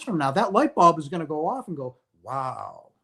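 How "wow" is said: a soft, stunned wow under the breath; quietly floored by a realization; hushed awe. Retold or imitated. Someone's light bulb goes off years later, hushed awe.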